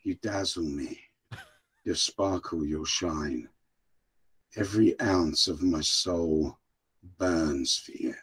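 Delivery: slow, low, hushed romantic English gentleman; very English farewell. Pretend. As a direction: woo her softly like a courtly Englishman. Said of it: "say bye to Vicky in the very English way".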